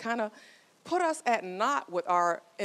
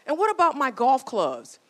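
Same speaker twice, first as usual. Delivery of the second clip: higher, louder nagging wife; wife's pushy question; 6 semitones higher, 9 dB louder than usual. Retold or imitated. A joking wife pressing her husband about the car, higher and louder. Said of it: "She goes".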